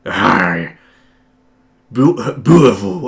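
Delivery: a loud, hammed-up pirate try; pirate voice attempt. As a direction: ham it up like a pirate, slowly. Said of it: "Are you doing a pirate voice?".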